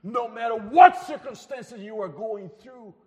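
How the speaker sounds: loud, emphatic pulpit declarations; preaching at full force. Acted. Preacher urging a grieving congregation, loud and emphatic. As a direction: hammer each yes home to the congregation.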